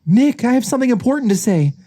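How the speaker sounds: high, urgent character voice; insistent Judy. Acted. Judy insisting to sleepy Nick.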